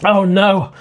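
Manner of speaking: usual speaking voice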